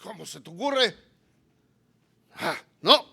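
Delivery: loud, outraged Chilean protest; mock angry rant. Preacher imagining being dragged out, mock outrage. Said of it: "me enojo y despotrico".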